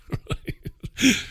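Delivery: breathless high giggle; real amused giggle; laugh. Real, not acted. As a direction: break into a quiet laugh. Laughing at Corbygeddon.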